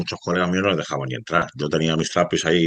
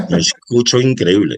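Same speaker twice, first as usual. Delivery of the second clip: high, loud retold amazement; amazed first reaction; 8 semitones higher, 6 dB louder than usual. Rapper quoting what he thought on hearing himself recorded, amazed and elated. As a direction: relive being thrilled by your own recorded voice. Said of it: "Eso fue lo primero que pensé".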